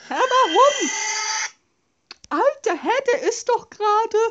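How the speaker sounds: high startled outburst; shocked. Real, not acted. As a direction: yelp in disbelief. Monster appears unexpectedly.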